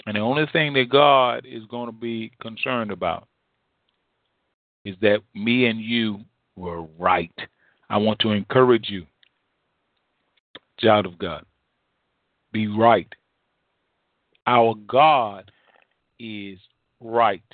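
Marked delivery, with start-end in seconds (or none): none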